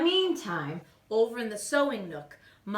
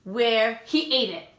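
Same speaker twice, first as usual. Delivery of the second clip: plain reading voice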